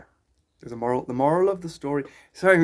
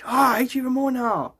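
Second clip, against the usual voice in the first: raised-pitch mock hater outburst; imitated exasperated hater; 8 semitones higher than usual. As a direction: play a hater losing it, lightly exaggerated. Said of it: "and then they're like".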